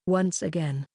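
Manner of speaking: usual speaking voice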